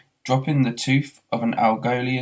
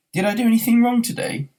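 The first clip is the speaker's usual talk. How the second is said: much higher, louder whine; Arthur, put-upon and plaintive; 12 semitones higher, 5 dB louder than usual. Acted. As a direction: ask the universe in despair.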